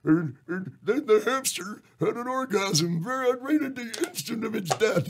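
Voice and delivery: high, slow, exaggerated reading of the lyric; hammed-up high recital of an obscene line; stodgy professor reading. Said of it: "when the guy reads out".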